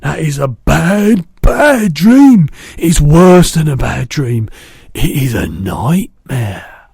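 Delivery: loud, slow, heavy distress; dread. A narrator's reading. BFG warning Sophie about the nightmare, loud slow dread. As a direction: stress the badness with dread.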